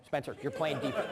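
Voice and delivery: lightly acted stern dad, low and soft; D dad mode. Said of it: "I was in D dad mode".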